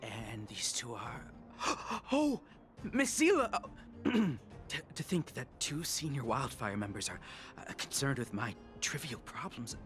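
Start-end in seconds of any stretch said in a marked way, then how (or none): 2.1-3.5 gasp, then high loud exclamation; high, loud, flustered astonishment; starstruck surprise. Acted. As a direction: blurt it out, startled by who's there. Startled to recognise a senior member.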